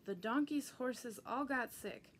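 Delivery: ordinary delivery, nothing marked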